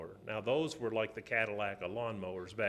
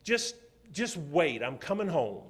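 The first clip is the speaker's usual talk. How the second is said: angry; 4 semitones higher, 5 dB louder than usual. Retold or imitated. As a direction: bite off the words, barely controlled. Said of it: "I was mad".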